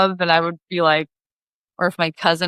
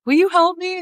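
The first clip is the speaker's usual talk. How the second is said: much higher, small plaintive ask; mock pleading; 12 semitones higher than usual. A fisherman with a tangled line asking her for help.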